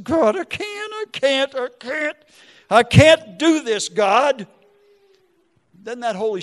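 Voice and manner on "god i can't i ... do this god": high, strained cry to God; tearful pleading. Retold or imitated. Father crying to God at daughter's bedside, desperate pleading. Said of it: "I'm crying".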